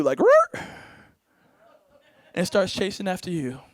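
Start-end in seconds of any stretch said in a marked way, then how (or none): 0.2-0.6 sudden shrill, loud bark; high loud bark. Pretend. Dog turns on the listener, a sudden high loud bark. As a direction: yelp at the audience like a dog. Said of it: "turns its attention to you".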